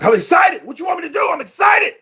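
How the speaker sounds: high, loud excited shouting; imitating a kid bursting with excitement. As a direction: hype it up like an overexcited young fighter. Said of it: "because he was like".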